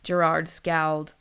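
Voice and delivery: plain reading voice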